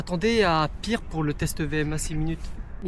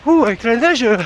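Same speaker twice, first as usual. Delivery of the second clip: excited exclaim; 4 semitones higher, 9 dB louder than usual. Real, not acted. Running in snow, exclaims.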